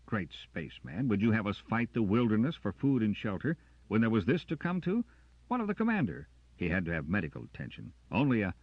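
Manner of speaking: rising in exasperation; heated argument. Acted.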